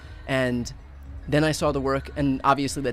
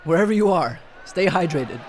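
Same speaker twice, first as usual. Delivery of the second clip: calling out; 5 semitones higher, 4 dB louder than usual. Real, not acted. Warning fainting crowd.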